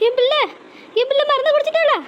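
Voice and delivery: anguished wail. Acted. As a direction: cry out for her child in horror. Mother learns of poisoning. (உயர்ந்த குரலில் வேதனை அலறல்)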